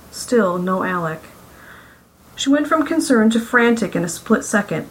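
ordinary delivery

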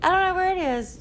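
put-on kid voice. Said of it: "I'm like".

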